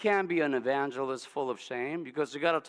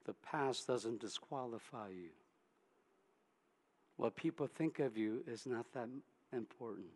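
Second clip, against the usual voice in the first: gentle reassuring voice; 4 semitones lower, 5 dB quieter than usual. Acted. Preacher voices Jesus gently.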